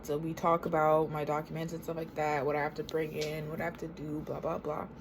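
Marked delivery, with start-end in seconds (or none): none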